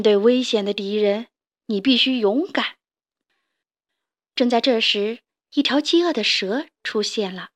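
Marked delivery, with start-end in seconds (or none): none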